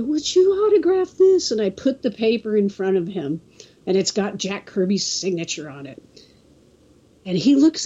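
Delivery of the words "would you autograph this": high-pitched imitation of a child asking; eager little kid. Kid asking Stan Lee for an autograph, eager. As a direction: pitch it way up like a hopeful young fan.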